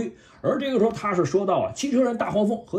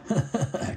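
villain laugh; 4 dB quieter than usual. Acted. Villain gloats over cornered Bumblebee.

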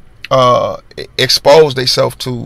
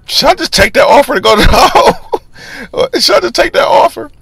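second-guessing, half laughing; nervous inner voice, pitched way up; anxious inner voice; 13 semitones higher, 3 dB louder than usual. Retold or imitated. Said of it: "I'm thinking".

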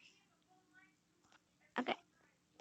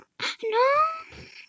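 gasp; 13 semitones higher than usual. Real, not acted. Scared gasp in game.

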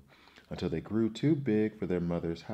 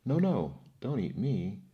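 higher, louder plea on the first words; crying out; 4 semitones higher, 5 dB louder than usual. A narrator's reading. Goat begs the troll not to eat him, crying out. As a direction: plead like a frightened goat, pitched up. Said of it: "cried the second billy goat".

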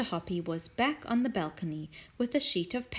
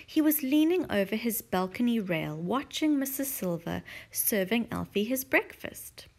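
ordinary delivery

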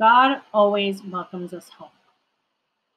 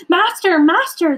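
high, loud, urgent calling; acted storybook panic; alarmed cry; 9 semitones higher, 7 dB louder than usual. A narrator's reading. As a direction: cry out to wake the master. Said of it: "they cried".

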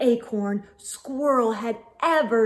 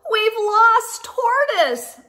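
high, slow, louder cry of alarm; worried call; 9 semitones higher, 5 dB louder, slower than usual. Acted. Friends calling to Squirrel that Tortoise is lost, alarmed. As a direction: call out the bad news.

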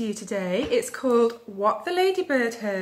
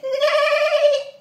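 loud, very high whinny; long piercing goose-as-horse neigh; full-might neigh; 8 dB louder than usual. A narrator's reading. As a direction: neigh with all your might. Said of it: "with all her might".

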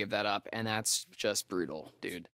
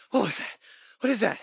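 high, louder panicked questions; frightened; 10 semitones higher, 5 dB louder, faster than usual. Acted. Hearing something in the dark, high panicked questions. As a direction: demand it in rising panic. Scared by unknown noise.

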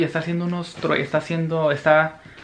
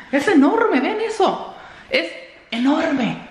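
high-pitched, giddy marvelling; amazed excitement; 11 semitones higher, slower than usual. Real, not acted. Vlogger showing viewers the huge new TV, amazed excitement. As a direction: marvel in a high squeal, stretching the last words.

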